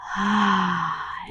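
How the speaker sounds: long, soft sigh out; audible exhale. Shown as a demonstration. Meditation guide demonstrates breathing, soft long sigh. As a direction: let the breath fall out in a sigh. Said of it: "including your breath".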